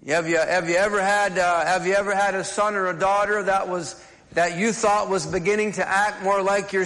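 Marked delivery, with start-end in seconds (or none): none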